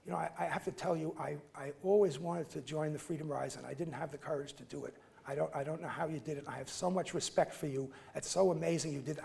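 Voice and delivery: quiet, fast, hushed admission; confiding confession. Retold or imitated. Older man quietly confessing to the speaker, hushed. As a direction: confess it softly and quickly.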